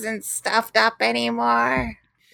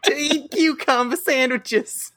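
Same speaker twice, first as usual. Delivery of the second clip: a lightly put-on American Southern accent, a little higher; 5 semitones higher than usual. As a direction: play the folksy Southern gentleman. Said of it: "British accents".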